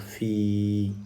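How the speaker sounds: "fi" with the i held long; long vowel. Shown as a demonstration. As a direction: stretch the i of "fi" evenly. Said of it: "sert d'outil d'allongement à la voyelle I".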